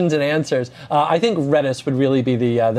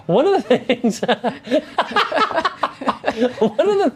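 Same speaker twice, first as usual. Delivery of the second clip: high, laughing through words; giggling while talking; 8 semitones higher than usual. Real, not acted. Laughing mid-sentence.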